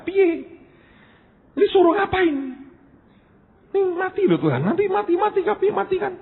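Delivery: high-pitched retold griping; complaining. Preacher at a loss before God over dying sick, complaining. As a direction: protest to God with the voice held way up. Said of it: "aku cuma mengeluh".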